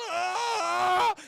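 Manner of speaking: long, high, loud yell; hammed-up agonized howl; mock scream. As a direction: scream out as the blob climbs the arm. Acting old man's scream.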